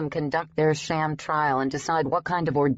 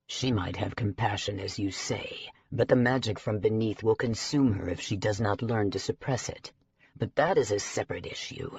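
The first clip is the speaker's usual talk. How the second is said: lower, measured voice of a stern dragon elder; lower-voiced stern elder giving the verdict; stern dragon leader; 5 semitones lower than usual. A narrator's reading. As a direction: speak as a grave council authority delivering a verdict. Bancroft's lines in the story.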